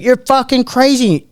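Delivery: high, drawn-out imitated protest; retold incredulous complaint; indignant mock yell. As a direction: stretch it out in mock outrage. Punched man answers in retold fight.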